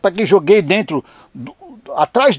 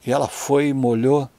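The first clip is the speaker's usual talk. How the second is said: usual speaking voice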